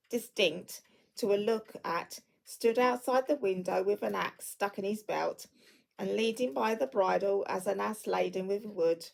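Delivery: ordinary delivery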